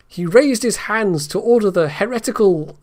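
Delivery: ordinary delivery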